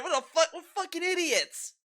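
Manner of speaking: incredulous outburst. Real, not acted. Outburst at Phil's complaints.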